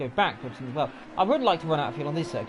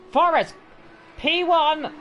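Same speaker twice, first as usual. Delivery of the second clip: sudden excited call, high and loud; 10 semitones higher, 6 dB louder, much slower than usual. Real, not acted. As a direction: shout the leader out with a jolt. Live race result announced.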